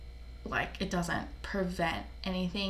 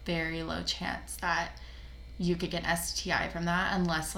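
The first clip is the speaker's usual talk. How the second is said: usual speaking voice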